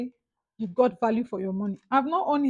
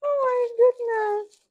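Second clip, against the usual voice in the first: high squeal of awe; 12 semitones higher, 4 dB louder, slower than usual. Real, not acted.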